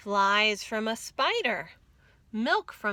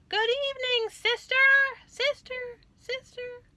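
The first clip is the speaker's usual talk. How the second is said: very high duck call, fading away at the end; quacking call; 4 dB louder than usual. A narrator's reading. Duck calls into the cave, high then fading. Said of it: "She quacked".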